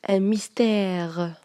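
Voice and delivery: "Un mystère" very slow, the "è" drawn out; hinting through pronunciation. Shown as a demonstration. Teacher giving away the accent to pupils, "mystère" slowly drawn out on the "è". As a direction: stretch the last vowel of "mystère" to show its sound. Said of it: "جبتها un petit peu في la prononciation".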